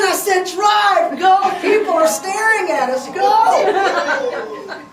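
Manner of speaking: loud, high, slowed shout of drive; frantic order. Retold or imitated. Wife cutting off her husband, loud frantic order. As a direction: cut him off and demand he go. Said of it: "She cuts him off".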